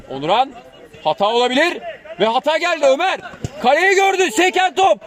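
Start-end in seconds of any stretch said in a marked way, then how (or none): 2.2-5.0 screaming football commentator. Acted. Goal call in match commentary.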